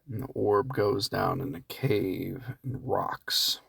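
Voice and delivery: slow, hushed whisper; slow spooky whisper as the orb sinks into the cave. Acted. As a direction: whisper it like a campfire ghost story.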